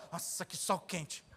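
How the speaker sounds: low, slow soft grumble; whiny complainer. Retold or imitated. Preacher mocks a believer grumbling about rain, low slow whine.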